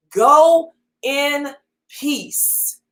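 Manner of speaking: very slow, stretched "Go in peace"; slow preached benediction; drawn-out proclamation. Acted. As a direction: stretch every word for weight. Preacher drawing out the phrase.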